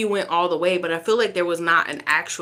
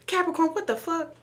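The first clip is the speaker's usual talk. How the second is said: high-pitched exclaim, pitch jumping up; startled outburst at the cards; shocked disbelief; 11 semitones higher than usual. Real, not acted. Shocked again by the reading.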